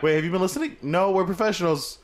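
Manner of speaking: hammed-up coast guard voice; voicing the coast guard. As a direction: play the nosy coast guard egging them on.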